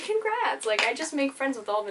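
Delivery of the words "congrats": high cheery quote. Retold or imitated. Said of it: "And I was like".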